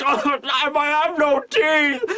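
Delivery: high, garbled mock wail; toothless victim. Beaten guy being force-fed noodles, high pained protest. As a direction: protest helplessly through a wrecked mouth.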